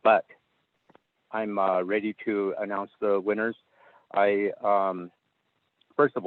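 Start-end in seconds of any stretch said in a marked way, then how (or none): none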